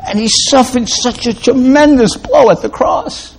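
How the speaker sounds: high-pitched, pleading sermon climax; impassioned preaching. Real, not acted. Preacher telling listeners Christ suffered for them, impassioned.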